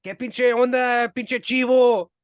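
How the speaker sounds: loud, hammed-up Mexican Spanish street talk; Mexican Spanish accent. Locals really asking after Willie, hammed-up Mexican Spanish accent. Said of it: "They more talk like".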